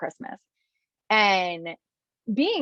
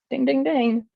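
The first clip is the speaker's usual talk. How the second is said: higher-pitched imitated ding-ding-ding; mock bell dings; 6 semitones higher, 4 dB louder than usual. Telling of her realization, imitated bell dings. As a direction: ring it out like a winner's bell.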